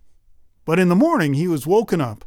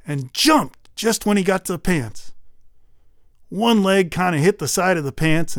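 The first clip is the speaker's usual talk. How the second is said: ordinary delivery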